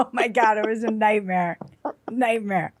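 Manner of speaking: usual speaking voice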